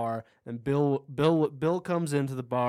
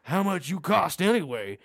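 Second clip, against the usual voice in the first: lightly put-on, raised and louder retort; mock rude cowboy; 5 semitones higher, 5 dB louder than usual. Cowboy to the women in the bar, mock rude. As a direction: imitate a crass gunslinger in a bar.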